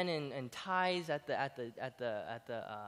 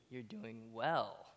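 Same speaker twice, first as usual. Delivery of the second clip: prim correction; 6 semitones lower, 8 dB quieter, much slower than usual. Retold or imitated. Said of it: "they're like".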